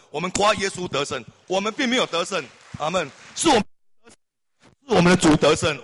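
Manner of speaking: high, loud, fervent sermon voice; rousing pulpit preaching; fiery preaching. Acted. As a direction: preach it out to the congregation. Preacher at full force.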